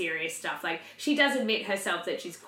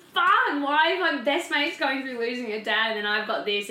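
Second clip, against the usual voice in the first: anguished outcry; 6 semitones higher, 5 dB louder than usual. Retold or imitated. Emotional outburst retold.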